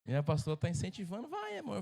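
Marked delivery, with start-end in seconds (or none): 1.3-1.8 much higher mock wife voice, coaxing; wife urging. Pastor imitating his wife urging him on, high coaxing voice. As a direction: coax him on in a high sweet voice.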